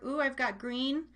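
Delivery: normal talking voice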